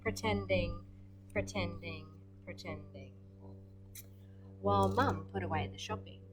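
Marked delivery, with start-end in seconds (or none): 1.3-3.1 trailing off. Real, not acted. Reader trails off repeating.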